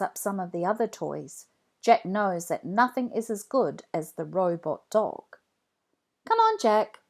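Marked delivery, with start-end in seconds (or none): 6.3-7.0 put-on high, bright kid voice, calling out; lively child greeting. A narrator's reading. Jack calls out to his friend Rebecca, high and loud. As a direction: call hello like an excited kid.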